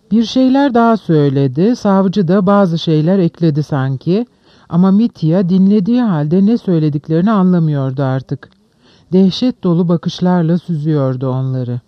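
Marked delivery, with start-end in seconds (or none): none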